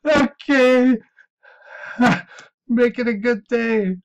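high, loud, laugh-choked farewell; real laughing sign-off. Real, not acted. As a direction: say goodbye while recovering from hysterics. Signing off while laughing hard.

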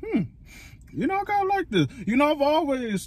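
chewing noodles while talking; talking between bites; mouth full. Real, not acted. Seen 0: Slurps noodles just before; mouth working while speaking early on.

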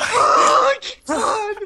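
high gasping fright; scared shriek. Real, not acted. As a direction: shriek in fear. Another scare.

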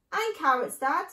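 smallest fox. A narrator's reading. Smallest fox asking Dad for carrots, piping up. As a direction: pipe up like an eager youngster. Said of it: "said the smallest of the three foxes".

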